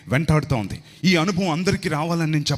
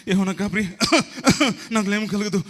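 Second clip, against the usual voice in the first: nervous coughing; 5 semitones higher than usual. Retold or imitated.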